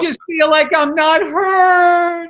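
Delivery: drawn-out, high fake wail; hammed-up sob, stretching the words; mock crying. Husband crying in a fight with wife, hammed-up wail. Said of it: "I'm gonna just do this".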